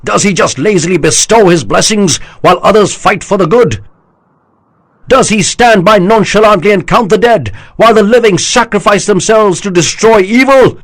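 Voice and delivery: high loud oratory, slowing at the end; rousing speech. A narrator's reading. General rallying his army, loud rousing oratory. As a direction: stir the troops with every word.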